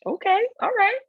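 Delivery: high, loud okay of surprise; pleasantly stunned reply. Retold or imitated. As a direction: squeak out a retold, thrilled agreement. Own stunned reply to Perry's offer.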